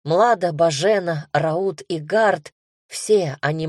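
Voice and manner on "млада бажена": plain reading voice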